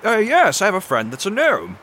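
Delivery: high, loud adventurer voice; Odysseus, eager. Acted. Plays Odysseus in the game.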